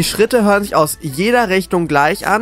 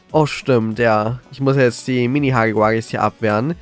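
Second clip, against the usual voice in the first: normal talking voice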